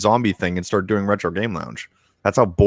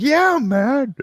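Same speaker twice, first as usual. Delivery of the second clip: mock mad; much slower than usual. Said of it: "You're like".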